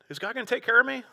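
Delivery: ask, then answer yourself; questioning then reassured David. Retold or imitated.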